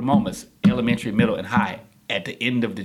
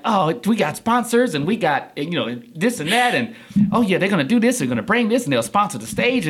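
higher, bubbling list of offers; eager organizers; 8 semitones higher than usual. Retold or imitated. Community organizers pitching sponsors to a newcomer, eager. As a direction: reel it off like keen event partners.

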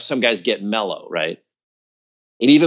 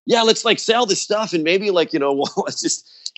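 high, eager put-on voice; imitating bandmates hatching a scheme; mock scheming bandmates; 8 semitones higher than usual. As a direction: pitch it like a sly sales plan.

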